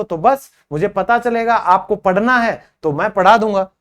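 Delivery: usual speaking voice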